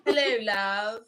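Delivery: put-on light British accent, laughing; lightly done British "Hello, love". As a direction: mimic a Brit greeting with a laugh. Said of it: "their accent is so awesome".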